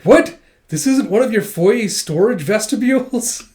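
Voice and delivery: high, loud, incredulous outburst; baffled rich kid, hammed up; astonished disbelief. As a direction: blurt it out in shock. Rich child astonished by small home.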